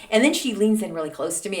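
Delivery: normal talking voice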